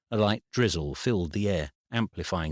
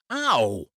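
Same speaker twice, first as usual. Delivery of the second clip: pained yelp; 6 semitones higher, 5 dB louder than usual. Acted. Pain cuts off his words.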